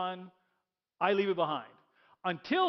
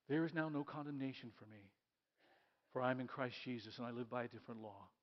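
quiet affirmation; 6 semitones lower, 10 dB quieter than usual. Acted.

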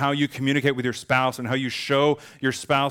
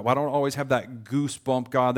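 normal talking voice

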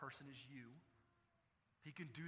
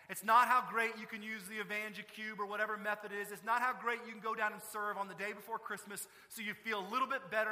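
much higher and louder, hammering "it's not" lines; preaching at full force; 6 semitones higher, 11 dB louder than usual. Real, not acted. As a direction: pound the list like a call to wake up. Preacher climaxes on church love.

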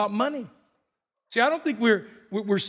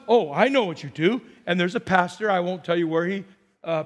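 normal talking voice

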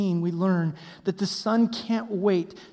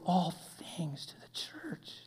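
whisper; 3 semitones lower, 14 dB quieter, slower than usual. Real, not acted. Preacher drops to hushed emphasis.

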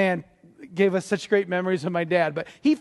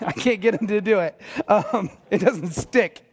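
ordinary talk

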